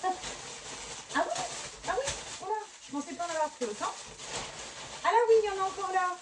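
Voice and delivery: rising high, fast and amazed; excited surprise. Real, not acted. Unboxing yarn for viewers, high and fast with excitement. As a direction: gush over the pile of yarn, pitch way up and speeding. Discovering too many yarn balls.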